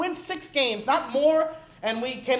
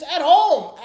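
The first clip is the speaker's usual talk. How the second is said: louder punch on home; loud emphatic; 3 semitones higher, 8 dB louder than usual. Real, not acted. Fan stressing the home loss to viewers, loud and emphatic. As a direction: slam the word home.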